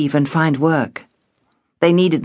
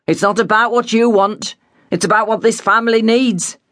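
lightly voiced firm father; a hint of a stern dad; 6 semitones higher than usual. A narrator's reading. Father refusing his daughter, lightly stern.

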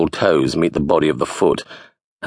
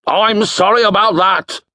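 put-on high, loud old wizard boom; 4 dB louder than usual. A narrator's reading. Wizard greeting Bingo, booming and loud. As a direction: project like a doddering wizard. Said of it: "booming voice".

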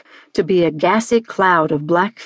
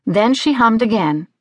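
usual speaking voice

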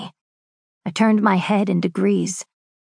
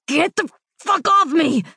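much higher, raging shout; furious yell; 10 semitones higher, 3 dB louder than usual. Acted. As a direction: spit it at the man holding you. Victor fighting off his brother.

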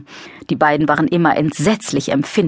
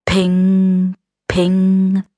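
high ringing ping sound; ping noise; 3 semitones higher than usual. Acted. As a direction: chime it like a metal ping. Same noise again. (helles Ping-Geräusch)

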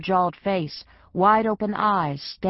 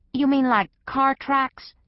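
higher, slower, a fussy house cat asking; a dainty cat who'd rather not search, drawn out; prissy cat voice; 8 semitones higher than usual. Acted. Gray cat asks the dog about tracks, high and prissy.

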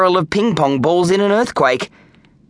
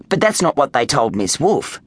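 usual speaking voice

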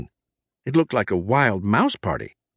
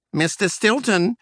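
high squeaky mouse voice, urgent; squeaky secretary; 10 semitones higher than usual. Acted. Secretary rushing in squeaks about misspelled words, high and urgent. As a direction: squeak out the bad news, rushing. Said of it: "she squeaked".

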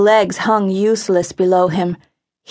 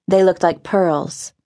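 ordinary delivery

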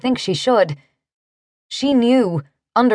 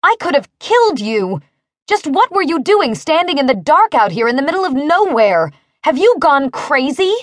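higher, indignant scolding; angry outburst; 6 semitones higher than usual. A narrator's reading. Driver scolding the man she nearly killed, angry outburst. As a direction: lay into him, pitch up. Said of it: "obvious surprise at her attitude".

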